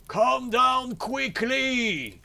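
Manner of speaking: slow, high, drawn-out bellow; shouted order. A narrator's reading. Bluebeard ordering his wife down, drawn-out angry shout. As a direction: bellow it up to her, stretching the words. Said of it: "cried Bluebeard".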